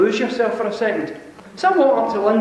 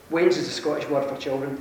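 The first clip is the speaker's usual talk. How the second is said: normal talking voice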